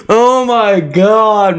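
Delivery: laughing oh my god. Real, not acted. Laughing at the throw.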